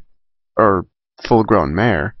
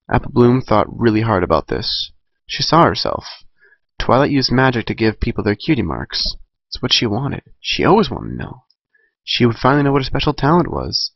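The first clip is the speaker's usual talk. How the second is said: ordinary delivery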